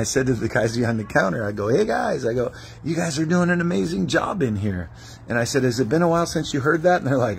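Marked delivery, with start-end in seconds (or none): 1.7-2.3 high, bright call of hey guys; cheery greeting. Retold or imitated. Greeting sandwich shop workers. 2.8-7.0 higher, quicker, enthusiastic compliment; upbeat praise. Retold or imitated. Praising sandwich shop workers.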